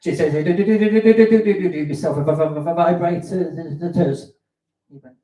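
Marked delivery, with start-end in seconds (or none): 0.3-4.3 juddering, loud stuttered words; shaking stammer. Acted. Comic acts a trembling lady buying a vibrator, loud shaking stammer. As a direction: stutter as if shaking all over. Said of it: "shaking like that".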